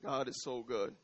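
ordinary delivery

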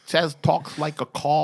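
mocking Boston vowels, slowly; Boston accent. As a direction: imitate a thick Boston accent, dragged out. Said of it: "Because he had a Boston accent".

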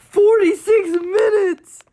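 loud high shocked exclamation. Real, not acted. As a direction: blurt it out in shock. Shocked at length.

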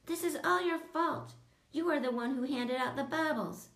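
high, accusing outburst; yelled. A narrator's reading. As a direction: blame him at the top of your voice. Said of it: "Coco yelled".

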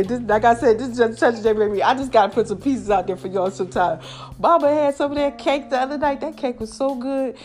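ordinary talk

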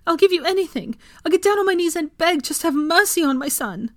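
high, loud, desperate begging; pleading. A narrator's reading. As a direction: beg for mercy at the top of the voice. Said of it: "Molly pleaded at him".

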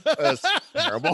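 said through high laughter; dad laughing at his own prank. Real, not acted. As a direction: laugh the words out. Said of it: "laughing at own story".

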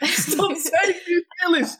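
very high, incredulous plea breaking with laughter; exasperated laughing protest. Real, not acted. Guest begging host to stop saying New Zealish, laughing protest. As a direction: wail the correction while cracking up.

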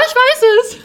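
sudden high shout of realisation; loud, high-pitched eureka cry; excited scream. Real, not acted. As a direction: shriek it out as it hits you.

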